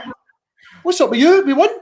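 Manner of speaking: high, loud mock indignation; retold raging. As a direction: imitate an aggrieved player protesting. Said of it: "He was raging with him".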